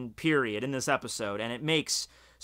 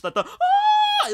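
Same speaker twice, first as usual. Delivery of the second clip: very high, loud, held screech; imitated screech; 8 dB louder, slower than usual. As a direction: shriek like a time portal opening.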